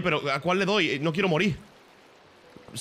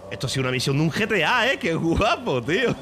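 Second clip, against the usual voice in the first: loud, raised excited voice; thrilled; 5 semitones higher, 5 dB louder than usual. Real, not acted. Player after a GTA-like mission, thrilled. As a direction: marvel loudly at the wild escape.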